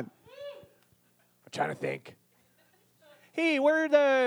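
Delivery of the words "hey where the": high, drawn-out bad Newfoundland accent; Newfoundland accent attempt. Shown as a demonstration. Host goaded into a demo, bad Newfoundland accent. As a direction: strain out a Muppet-like Newfoundland accent. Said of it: "Do the Newfoundland accent".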